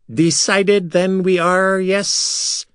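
high, slow alien creature voice. A narrator's reading. Silver Sand creature asking the others. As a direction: stretch the words out high and strange. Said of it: "one of the Silver Sands asked the others".

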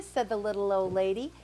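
ordinary delivery

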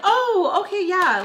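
high, loud forced brightness; fake cheerful. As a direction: cover the sting with big cheer. Fake-cheerful reply to rejection.

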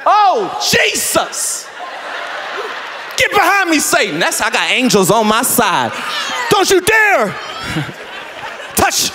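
high, loud mock exorcist shout; hammed-up rebuke. Pastor fending off the volunteer, shouting high. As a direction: cast the devil off, all theatre. Acted spiritual fight.

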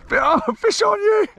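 high-pitched excited call; excited squeal. Real, not acted. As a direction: squeal it out to your mate. Fish hooked by mate.

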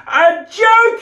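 high, loud incredulous outcry; cried out. Acted. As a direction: fling it back in outrage. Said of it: "he cried".

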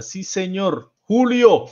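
high, loud shout-out; excited congratulation. Real, not acted. Host hails a viewer for the right answer, excited. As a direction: call out the winner's name in delight.

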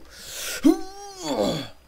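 long high loud gasp of shock; big startled intake of breath. Real, not acted. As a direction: gasp in real horror at the screen. Villagers trapped in the glass.

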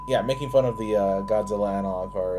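ordinary talk, nothing marked

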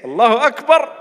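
loud high cry of Allahu Akbar; ringing takbir exclamation; exclaimed takbir. Real, not acted. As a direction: call it out with full voice. Exclaims after being told to say it.

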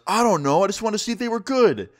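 high-pitched, protesting excuse; acted-out helpless protest; flustered defensiveness. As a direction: plead your silly excuse, pitch way up. Jim defends himself in acted quarrel.

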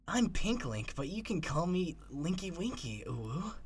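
lightly voiced cutesy character; twinkling voice. Shown as a demonstration. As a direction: introduce yourself in a twinkling little voice. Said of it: "the twinkling voice".

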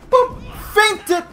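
very high little boop voice; playful squeak. Pretend. Player faking out an opponent, high teasing squeak.

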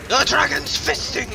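high, loud mock cry; hammed-up shout. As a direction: overblown battle yell.

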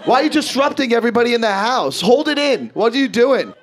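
high, loud mock rage at sneezing; mock furious parent; family yelling. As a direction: bark it like an angry Italian parent. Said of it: "you'd be like".

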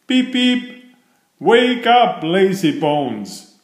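high-pitched engine whistle cry; high, teasing wake-up call; loud whistle call. A narrator's reading. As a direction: toot it out high, then call the sleeper awake. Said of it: "whistled loudly".

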